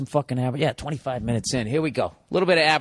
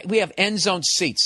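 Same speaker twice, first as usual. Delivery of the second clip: ordinary talk, nothing marked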